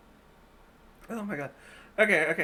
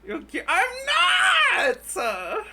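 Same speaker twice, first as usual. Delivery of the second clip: high, crying-laughing shout of denial; laughing protest; 10 semitones higher than usual. Real, not acted. Protesting to the friend, laughing hard. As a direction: squeal it out through laughter.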